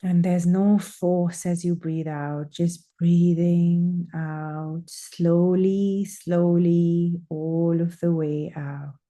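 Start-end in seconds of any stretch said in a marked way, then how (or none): none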